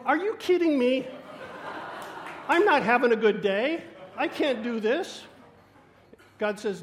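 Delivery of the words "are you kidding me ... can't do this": higher, louder exasperated protest with a laugh. Retold or imitated. Preacher replaying his loud protest to God. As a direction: push back at God in disbelief.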